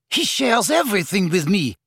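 high, heated boast; indignant, high-pitched insistence; fired up. A narrator's reading. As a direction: flare up defensively. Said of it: "firing up at once".

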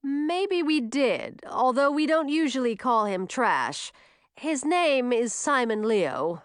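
slow, amused and teasing; smiling. Acted. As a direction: let a grin creep into it. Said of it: "beginning to smile".

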